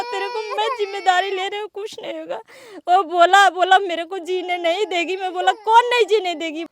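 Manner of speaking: words pushed out through sobs; crying. Real, not acted. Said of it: "महिला है जो रो रही है".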